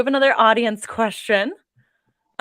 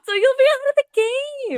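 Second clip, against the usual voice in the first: excited; 6 dB louder than usual. Real, not acted. Excited reassurance to co-author.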